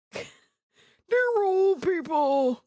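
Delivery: put-on old man voice. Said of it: "I'm like".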